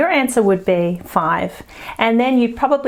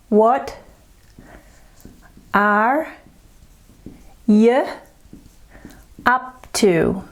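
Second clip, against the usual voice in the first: word by word; much slower than usual. Shown as a demonstration. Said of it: "Let's look at this one here".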